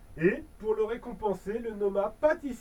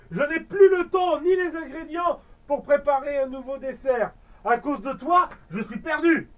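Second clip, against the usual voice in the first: louder, higher lament; despairing pastry chef; 5 semitones higher, 6 dB louder than usual. Acted. Pastry chef blames the elf, despairing louder lament. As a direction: plead that all is lost. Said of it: "s'écria le pâtissier désespéré".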